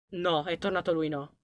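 a hushed "No, è tornato lui"; frightened hush. Real, not acted. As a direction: breathe it so the monster won't hear. Monster returns, hiding.

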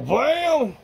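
big high-pitched showman's bam; excited exclamation. Real, not acted. Reviewer showing off the stick to viewers, excited exclamation. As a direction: burst out high and drawn out, like a reveal.

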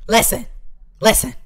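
loud and pressing; insistent listen. Real, not acted. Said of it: "Now listen".

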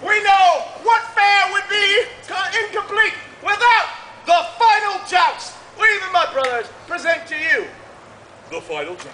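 booming showman announcement; carnival barker. Acted. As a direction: sell it to the crowd.

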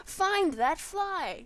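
loud, slow mock order; mock command. Player orders viewers to find her lost item, loud mock command. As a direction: punch out each word like an order.

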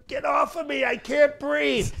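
mock wife crying out. Comic mocking Christie's wife in bed, hammed-up cry. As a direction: play a wife pinned under her husband. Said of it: "You know what she calls him?".

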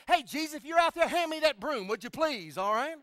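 put-on loud, high-pitched little boy's holler; yelling out. Scared boy asking Jesus for the broom, yelled out. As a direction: call urgently out the door into the dark. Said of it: "yelled out".

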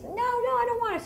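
put-on very high, whiny little-kid voice; whiny toddler. Toddler refusing her mother, whining high.

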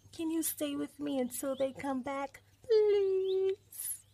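high begging voice, long drawn-out please; pleading cub. Acted. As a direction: beg like a little cub, climbing on the last word. Cub begs wolf to stay.

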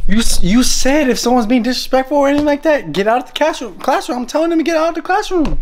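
high-pitched pretend argument; whiny protest. Pretend student arguing with teacher.

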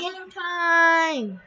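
loud victorious call; excited shout. Real, not acted. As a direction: shout it like a win. Celebrating a point.

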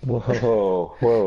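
normal talking voice

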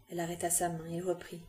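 plain reading voice

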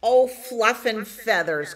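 lightly voiced clucking hen, put out. A narrator's reading. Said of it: "clucked hen".

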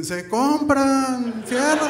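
very high, very slow wailing street-vendor cry; changed wail. Retold or imitated. The Llorona switches her cry to a street vendor's call. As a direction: wail it out like a ghost turned scrap-buyer. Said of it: "cambia el grito".

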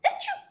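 very high sneeze; a sharp squeaky sneeze. Acted. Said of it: "Ratoncita Martina went".

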